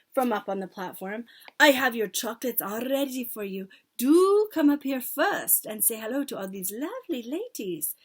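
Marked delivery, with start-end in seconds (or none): 1.6-7.9 sugary, coaxing witch voice; falsely sweet hostess welcome; dripping with syrup. A narrator's reading. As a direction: coax the child with gushing sweetness. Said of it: "soft and gentle and absolutely dripping with syrup".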